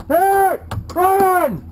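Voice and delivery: shouting for a medic; man down shout. Real, not acted. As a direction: yell it across the field. Calling teammate, man down.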